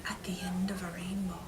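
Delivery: hushed, slightly quick secret; secret whisper. Acted. Teacher whispering where leprechauns hide to the class. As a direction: lean in and whisper it.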